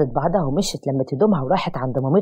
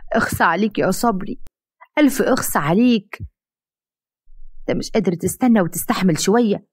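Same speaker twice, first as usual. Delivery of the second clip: higher, put-on wailing reproach; crocodile tears; 4 semitones higher than usual. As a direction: fake the hurt, wail it for show. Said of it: "وقعدت تعيط وتنزل دموع التماسيح".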